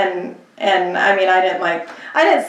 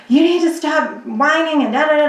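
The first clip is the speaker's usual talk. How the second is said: mock yell at a kid; 8 semitones higher than usual. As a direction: bark at a whining child, imitated. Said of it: "totally yelling at his kid".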